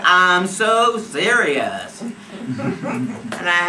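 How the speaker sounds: loud, very slow, drawn-out insistence; emphatic insistence. Retold or imitated. Woman to joking, smoking friends, drawn-out insistence. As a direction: stretch every word to be believed.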